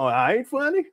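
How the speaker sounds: mock protest. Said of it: "I said".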